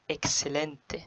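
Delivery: إكسلنت said as ekselente, with a clear ks; Spanish excelente with ks; model pronunciation. Shown as a demonstration. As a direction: demo إكسلنت with ks in the middle. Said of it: "أنا نطقت إكسلنت".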